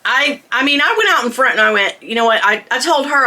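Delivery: normal talking voice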